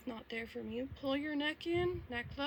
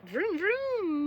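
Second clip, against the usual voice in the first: engine noise; 8 semitones higher, 8 dB louder than usual. Pretend. Imitates engine.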